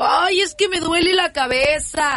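high, slow whiny complaint; whiny husband. Acted. Husband begs off to his wife, high slow whine. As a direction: moan it out like a pitiful husband.

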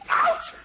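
sudden high yelp; sharp cry of pain. Acted. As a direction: yelp as your ear gets hurt. Phone receiver hurts his ear.

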